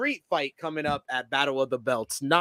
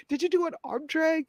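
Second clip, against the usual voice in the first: put-on higher, soft voice talking down to a child; patronizing coo; 9 semitones higher, 5 dB quieter than usual. Imitating Toni Storm talking down to her partner. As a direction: ask as if praising a toddler. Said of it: "[name] saying".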